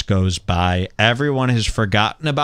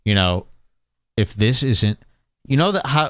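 ordinary talk

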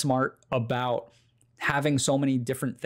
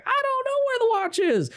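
high wife voice. Retold or imitated. Wife refusing to tell the cop, squeaky high denial. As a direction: mimic the wife in a squeaky falsetto. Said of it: "She's like".

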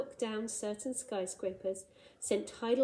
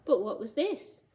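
plain reading voice

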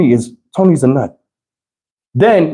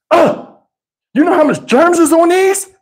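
mock wife; 13 semitones higher than usual. Imitating wife's germ lecture.

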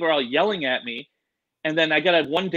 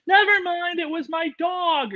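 high slow squeaky imitation; mock high viewer; slower than usual. Viewer brushing it off to the host, high slow mock voice. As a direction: drag it out in a silly high voice. Audience, mocked.

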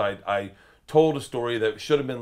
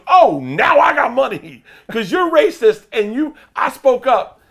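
drawn-out, loud sarcastic accusation; high-pitched imitation of an offended star; Tracy Morgan, outraged; 12 semitones higher, 13 dB louder, slower than usual. Comic plays the star accusing the waitress, loud outrage.

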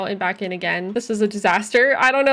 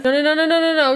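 loud repeated no; 6 semitones higher, 5 dB louder, faster than usual. Real, not acted. Stopping Sim's bad action.

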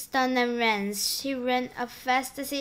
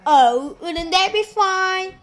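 higher, louder gushing aunt; vain aunt Sponge; 5 semitones higher, 5 dB louder than usual. Acted. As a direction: gush over yourself loudly. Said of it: "and Sponge declared".

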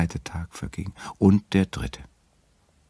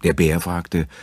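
ordinary delivery

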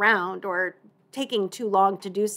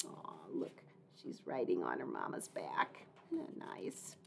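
soft whispered aside; whispering; 15 dB quieter than usual. Real, not acted. Aside about the picture.

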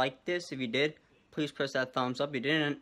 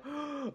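gasp; 6 dB quieter than usual. Acted. Mock surprise at ending.

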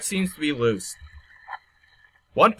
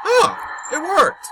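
excited shout; 13 semitones higher, slower than usual. Real, not acted. Puzzle solved.

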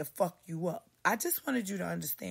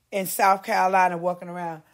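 ordinary talk, nothing marked

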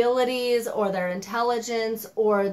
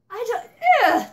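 sudden high, loud groan of frustration; an exasperated outburst about the heroine; exasperated ugh; 12 semitones higher, 6 dB louder than usual. Real, not acted. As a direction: throw up your hands at it. Venting irritation at the book.

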